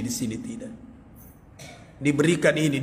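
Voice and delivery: ordinary talk, nothing marked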